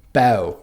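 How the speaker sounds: Cockney accent, "Bell" said slowly as "bow"; Cockney demo, end "Bell" in an ow sound. Shown as a demonstration. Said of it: "replaced with an ow sound".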